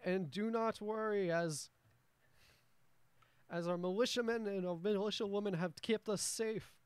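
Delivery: high, loud speechifying to a crowd; a stout dwarf mayor booming reassurance; pompous dwarf mayor. Acted. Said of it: "you hear him go".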